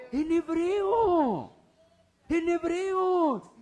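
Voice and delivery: loud, high, slow stress on HEBREO; emphatic answer. Real, not acted. Preacher driving home to the congregation that they heard hebreo, loud and slow.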